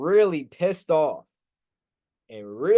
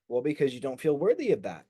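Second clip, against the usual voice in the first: ordinary talk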